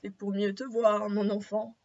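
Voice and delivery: low-pitched pretend grandmother; wolf's grandmother voice. Wolf answers girl from the bed, low grandmother voice. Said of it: "répond le loup de sa voix de Mère Grand".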